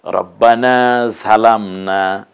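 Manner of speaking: slow recitation, ঝালামনা starting with a buzzing z sound; "ঝালামনা" with a voiced z hissing at the teeth; said with zha. Shown as a demonstration. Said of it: "ঝা দিয়ে বলেছি".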